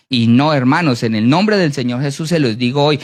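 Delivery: usual speaking voice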